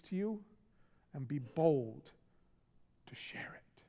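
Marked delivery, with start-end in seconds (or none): 3.1-3.8 whisper. Real, not acted. Preacher whispers the call to share it. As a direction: drop to a breathy whisper. Sermon, dropping to a close.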